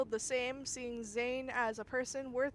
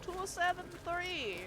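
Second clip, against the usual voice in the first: vampire voice; 7 semitones higher than usual. Pretend. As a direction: count it out like Dracula. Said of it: "I'm doing my vampire voice".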